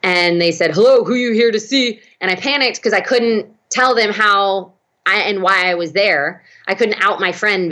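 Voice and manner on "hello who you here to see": hammed-up security guard on intercom; gruff guard over intercom. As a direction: bark it through the call box. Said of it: "a very gruff voice came over".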